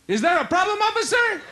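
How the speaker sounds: high, exaggerated coy question; hammed-up sweet talk to the officer; copying his girlfriend's coy act. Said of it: "So I tried it".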